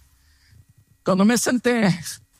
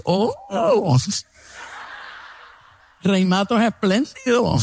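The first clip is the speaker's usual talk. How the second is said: high mock surprise on oh oh; 4 semitones higher than usual. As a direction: tease him as if shocked. Retold rival mocks his generosity. (sorpresa burlona aguda)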